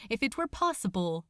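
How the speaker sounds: usual speaking voice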